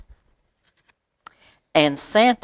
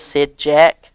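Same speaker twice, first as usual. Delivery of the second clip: plain reading voice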